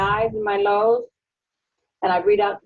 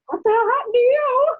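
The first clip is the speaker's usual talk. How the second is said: high-pitched astonished exclamation; imitating astonished friend; 3 dB louder than usual. Patient retells friend's shock at her louder voice, high astonished mimicry. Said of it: "Lou says".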